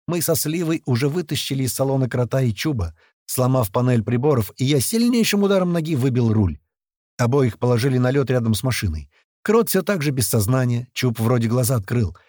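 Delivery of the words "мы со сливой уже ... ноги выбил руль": ordinary delivery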